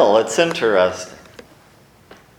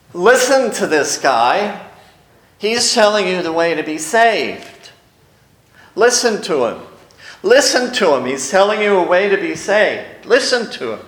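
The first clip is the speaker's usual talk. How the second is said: raised calling-out voice; 6 semitones higher than usual. Retold or imitated. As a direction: call after the crowd like a street crier. Girl following Paul, calling out.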